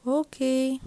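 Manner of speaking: usual speaking voice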